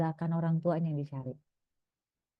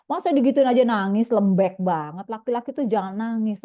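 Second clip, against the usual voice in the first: scolding parent; 6 dB louder than usual. Retold or imitated. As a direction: shame the boy for crying. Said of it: "gitu ya respon kita". (orang tua membentak anak laki-laki yang menangis)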